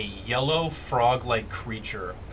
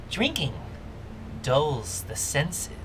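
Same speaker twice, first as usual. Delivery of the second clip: frog voice; 5 dB quieter, slower than usual. Acted. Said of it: "how it was gonna do the frog voice".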